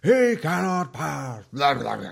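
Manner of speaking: grand mock wizard decree; Gandalf impression. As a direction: thunder it out like Gandalf on the bridge. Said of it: "he's like".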